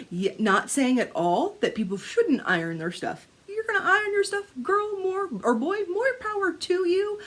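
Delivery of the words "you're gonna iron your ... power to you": high, singsong encouragement; high-pitched cheering on. Real, not acted. Telling viewers who iron more power, high playful voice. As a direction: cheer them on in a lifted, playful voice.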